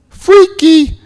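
high, loud single word; amazed exclamation. Real, not acted. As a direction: call it out in playful amazement. Comments on the uncanny job call.

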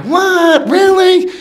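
squeaky high what, really; shocked disbelief. Retold or imitated. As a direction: shoot up in disbelief. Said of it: "I'm like".

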